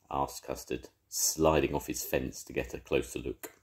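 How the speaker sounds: lively read-aloud for little ones; storybook narration. A narrator's reading. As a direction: tell it like a grandad at bedtime. Narration between voices.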